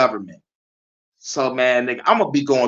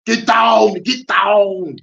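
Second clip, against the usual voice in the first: Arnold shout; 6 semitones higher, 4 dB louder, much slower than usual. Retold or imitated. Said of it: "Pause with it".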